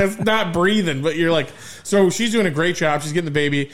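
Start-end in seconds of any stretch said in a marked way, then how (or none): 0.0-1.0 mock panicked yell. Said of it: "grab my wife's head and be like".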